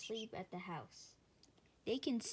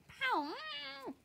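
bellowed bark. Acted. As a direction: bellow it like a dog. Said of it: "bellows the dog".

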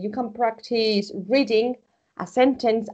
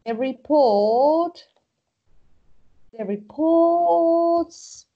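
"report's" drawn out slowly, raised and louder; slow model reading; 6 semitones higher, 4 dB louder, much slower than usual. Shown as a demonstration. Teacher modelling the contraction slowly for students. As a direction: spell out "The report's" slowly for the class. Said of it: "puedo decir".

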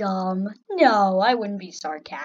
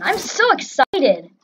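sarcastic excitement; 4 semitones higher, 7 dB louder than usual. Pretend. Player facing dying over and over, sarcastic excitement. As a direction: gush it with heavy emphasis on "so". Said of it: "I was being sarcastic".

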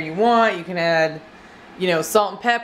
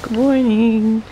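gentle wake-up; much slower than usual. Acted. Waking a napping partner.

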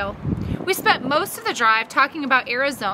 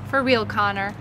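lower, assured answer, falling; calm confirmation; 4 semitones lower than usual. Acted. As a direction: confirm it calmly to an amazed boy. Mom confirms calmly in answer.

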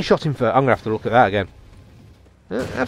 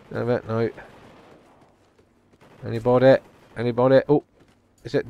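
ordinary talk

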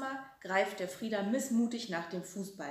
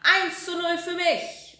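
high, loud, slow cheer; triumphant roar; 10 semitones higher, 10 dB louder, slower than usual. A narrator's reading. Frieder cheers his goal, triumphant shout. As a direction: crow it like a football fan. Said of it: "brüllt der Frieder".

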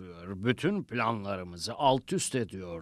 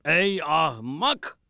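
scolding; 4 semitones higher, 4 dB louder, slower than usual. Acted. Devil scolds his underling.

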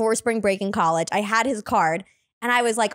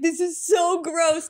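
higher, drawn-out groan of distaste; mock disgust; 5 semitones higher, slower than usual. Boy complains while kissing her, mock disgust. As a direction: stretch the words in imitated revulsion.

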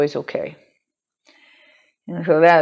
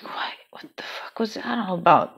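hushed, breathy what-the-hell; whispering to herself, spooked by a voice nobody spoke; whispered disbelief; 2 semitones higher, 5 dB quieter than usual. Retold or imitated. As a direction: whisper it like you can't believe your ears. Reacting to a mysterious voice.

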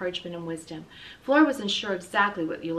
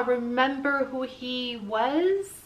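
slow urging; 4 semitones higher, much slower than usual. A narrator's reading. Flora urging Ulysses.

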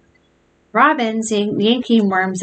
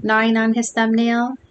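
ordinary delivery

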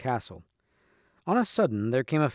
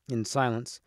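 plain reading voice

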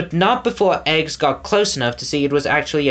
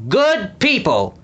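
high, slow booming call; thundering voice; 7 semitones higher, slower than usual. A narrator's reading. Lord Portly Rind addresses the crowd in his thundering voice. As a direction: boom it out to the crowd. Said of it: "his thundering voice".

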